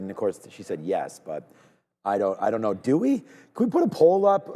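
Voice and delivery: ordinary talk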